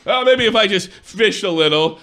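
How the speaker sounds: usual speaking voice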